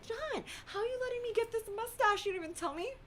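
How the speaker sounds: high-pitched whiny mock complaint; squeaky, put-out complaint in jest; mock indignation. Mock complaint to husband about mustache.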